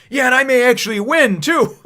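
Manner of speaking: loud, high-pitched comeback; defiant retort. Retold or imitated. Nami answering the villain who mocked her, defiant retort. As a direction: imitate a cocky answer to a rival.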